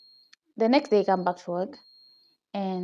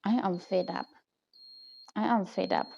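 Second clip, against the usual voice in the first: softer, weary inner thought; fed-up inner voice; 2 semitones lower, 7 dB quieter than usual. Retold or imitated. Worker thinking to herself at her desk, quietly fed up. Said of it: "my mind was like".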